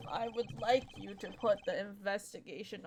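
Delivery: a hint of a weary old diplomat; very old man. Ambassador voice again.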